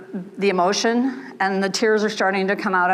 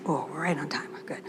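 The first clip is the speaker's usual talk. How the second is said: whispered aside; 5 semitones lower, 11 dB quieter than usual. Real, not acted. Aside to herself about timing.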